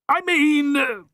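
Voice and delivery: high flustered backpedal; flustered young man. Acted. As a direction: backpedal quickly. Harry backpedals.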